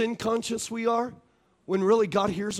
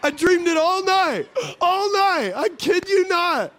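laughing; 8 semitones higher, 4 dB louder, slower than usual. Real, not acted. Laughing retelling of tooth dream.

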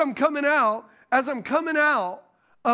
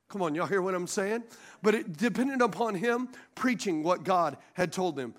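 ordinary talk

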